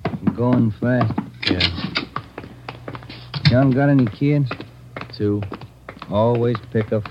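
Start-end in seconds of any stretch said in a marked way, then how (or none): 0.0-1.1 slow. Acted. Partner quotes doctor, slowly.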